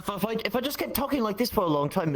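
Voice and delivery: lightly attempted British lilt; British accent. Shown as a demonstration. Showing a friend they can sort of sound British, British accent. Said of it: "You kinda sound British now".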